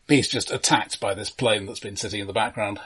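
normal talking voice

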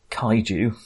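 ordinary talk, nothing marked

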